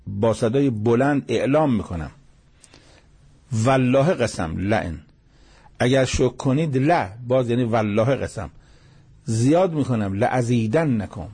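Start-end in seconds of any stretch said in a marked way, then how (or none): none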